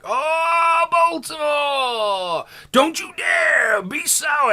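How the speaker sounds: high, drawn-out shouted promo call; Big E Langston impression. Wrestling fan hamming up Big E's promo line to viewers, high slow shout. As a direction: belt it out high like a hyped wrestler on the mic. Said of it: "like the good old Biggie".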